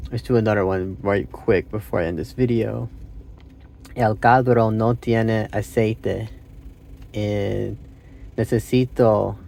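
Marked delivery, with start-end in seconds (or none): 3.9-9.4 very slow, searching beginner's Spanish; broken Spanish. Real, not acted. Learner attempting a car sentence, slow broken Spanish. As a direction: grope for each Spanish word with pauses. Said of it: "say it in my broken Spanish".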